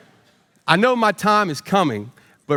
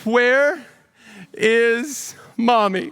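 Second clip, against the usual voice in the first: mock teary child, higher and very slow; teary little boy; 6 semitones higher, much slower than usual. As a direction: drag out "is mommy" like a sad toddler. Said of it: "with his head down and tears filling his eyes".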